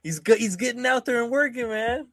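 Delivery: mock hype. Teasing hype for candidate.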